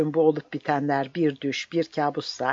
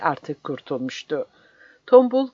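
ordinary delivery

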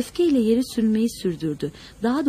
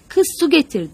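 high, loud, urgent order; alarmed woman calling for help; flustered shout; 5 semitones higher, 6 dB louder than usual. A narrator's reading. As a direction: shout it out in a panic. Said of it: "diye bağırdı kadın telaşla".

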